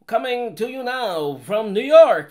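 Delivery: high, loud and slow announcer voice; old radio announcer voice. Retold or imitated. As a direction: boom it out like a vintage radio host. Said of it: "that radio voice is like".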